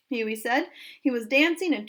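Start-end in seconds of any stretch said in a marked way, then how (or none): none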